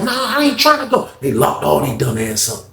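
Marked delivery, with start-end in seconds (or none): 0.0-1.1 much higher, whiny refusal; high protesting boy. Retold or imitated. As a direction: plead not to go. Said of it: "Boy was like".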